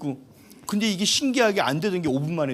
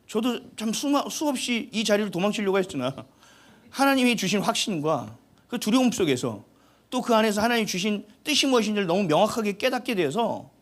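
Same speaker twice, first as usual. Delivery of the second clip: usual speaking voice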